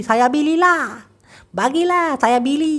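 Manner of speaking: high, teasing voice saying বিলি for বলি; mock wrong pronunciation. Teacher mocking students who wrongly say বিলি, high teasing voice. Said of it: "just বোঝানোর জন্য একটু ইয়ার্কি বাজ দিলাম".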